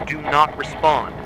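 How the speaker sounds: ordinary talk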